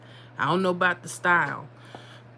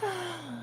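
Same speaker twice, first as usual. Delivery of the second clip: contented sigh; 10 semitones higher, 12 dB quieter than usual. Real, not acted. Savoring pizza memory.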